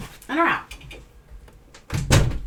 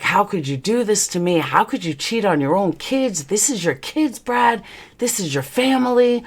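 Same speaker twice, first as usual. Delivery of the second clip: retold anguished accusation; 6 semitones higher, 4 dB quieter than usual. Wife confronting husband over the kids, anguished reproach. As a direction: plead and accuse, pitch rising as you go. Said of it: "I mean, I, it was ugly".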